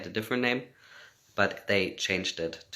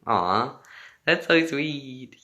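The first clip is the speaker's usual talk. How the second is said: touched aww; 6 semitones higher, 6 dB louder, much slower than usual. Real, not acted. Touched by a fan comment.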